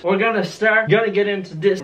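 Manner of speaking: usual speaking voice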